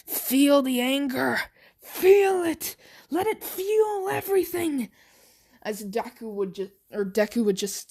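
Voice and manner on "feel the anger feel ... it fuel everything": gloating villain. Acted. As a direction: egg him on with rising glee. Villain urges rage.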